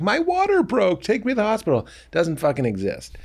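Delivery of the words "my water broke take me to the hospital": high, urgent sitcom cry; mock sitcom mom announcing her water broke; movie cliché panic. Parodying a sitcom wife to her husband, high and urgent. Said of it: "every movie is always like".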